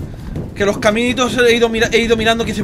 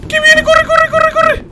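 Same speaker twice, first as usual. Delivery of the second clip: very high, loud panic climbing into shrieks; terrified shouting; 6 dB louder, slower than usual. Real, not acted. Streamer chased by the monster, terrified screaming. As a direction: scream the words over and over in real terror.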